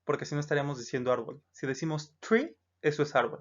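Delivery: ordinary talk, nothing marked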